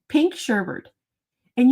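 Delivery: normal talking voice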